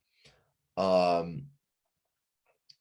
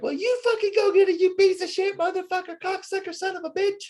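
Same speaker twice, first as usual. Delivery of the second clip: much higher, squeaky mock rant; mocking bandmate; 3 dB louder than usual. Singer mocking guitarist cursing him out, much higher mock voice. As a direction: lampoon him as a shrill cursing brat. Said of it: "that's how he talked too".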